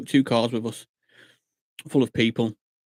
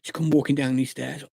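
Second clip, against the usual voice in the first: usual speaking voice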